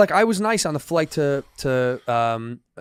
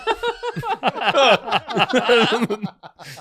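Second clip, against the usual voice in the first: laugh; 8 semitones higher than usual. Real, not acted.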